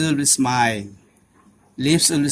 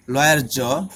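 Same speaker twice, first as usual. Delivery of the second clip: ordinary talk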